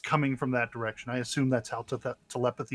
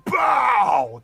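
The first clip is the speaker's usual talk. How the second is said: slow, roaring command; enraged dark lord; 8 semitones higher, 7 dB louder, much slower than usual. Acted. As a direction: bellow it like an angry god. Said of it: "more enraged, more demanding".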